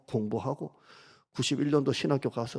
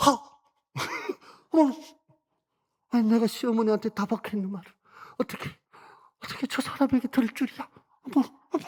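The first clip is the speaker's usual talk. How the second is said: gasping, much higher, shocked Ruth; startled gasp; 11 semitones higher, 4 dB louder, faster than usual. Acted. Preacher acting out Ruth's shock at Boaz's words, gasping. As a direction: clap hands to mouth and gasp in disbelief. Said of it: "두 손으로 입을 막으면서 '허!' 하고 소스라치게 놀라다".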